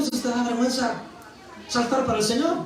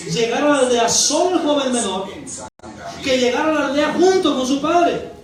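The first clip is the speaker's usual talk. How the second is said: raised, emphatic sermon delivery; impassioned preaching; 4 semitones higher than usual. Real, not acted. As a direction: drive each point home to the congregation. Preaches at full force. (predicación enérgica y apasionada)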